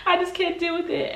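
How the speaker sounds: high, whiny pleading; begging. Retold or imitated. Daughter begging mom to keep the dog, high whine. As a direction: beg like a desperate kid. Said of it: "I'm like".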